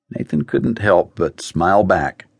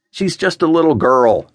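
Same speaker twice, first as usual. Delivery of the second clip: a high, louder, sharp snap of protest; snapped; 9 semitones higher, 4 dB louder than usual. A narrator's reading. Jasmine snapping at Nathan to defend the girl, sharp higher snap. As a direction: bite the words off, higher and louder. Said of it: "Jasmine snapped".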